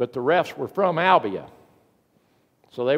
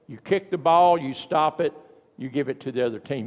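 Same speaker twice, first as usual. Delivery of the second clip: ordinary talk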